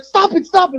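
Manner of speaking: high-pitched, panicky repeated command; alarmed plea. Retold or imitated. Employee telling the man to stop, alarmed. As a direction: re-enact a freaked-out order, voice way up.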